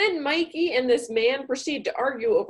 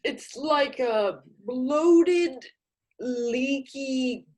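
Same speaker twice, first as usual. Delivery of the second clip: droning accountant; much slower than usual. Acted. Accountant describing bowel trouble.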